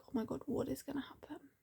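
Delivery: hushed, breathless whisper of suspense; whispered panic. Retold or imitated. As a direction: whisper it like you can't bear the cliffhanger. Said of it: "I'm still like".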